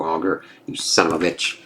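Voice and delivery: ordinary delivery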